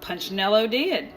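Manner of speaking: usual speaking voice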